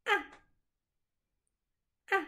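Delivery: A dropping sharply from high; A cut short and falling hard; A in fourth tone, sharp high fall. Shown as a demonstration. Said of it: "Et le quatrième ton".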